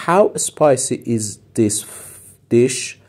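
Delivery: How spicy is this dish said word by word, very slowly; slow model phrase. Shown as a demonstration. Teacher slowly models the English phrase for students, very slow. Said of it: "slow model of phrase".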